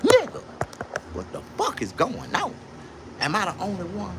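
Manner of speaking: high-pitched baffled complaint; retold exasperation. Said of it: "I would get so goddamn frustrated".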